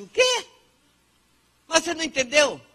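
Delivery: high, loud incredulous "what?"; exasperated disbelief. Retold or imitated. Person not understood, speaking to the other, in loud disbelief. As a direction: act out someone baffled at not being understood. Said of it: "fala assim".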